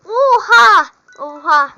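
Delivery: high, loud gasp of amazement; amazed shout. Real, not acted. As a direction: shout it out in disbelief. Friend gives rare item. (hediyeye şaşkınlıkla bağırış)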